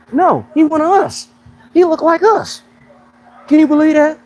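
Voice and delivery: high excited squeal of pride; excited disbelief. Real, not acted. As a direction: rave that he's one of us. Marveling, hyped about the athlete.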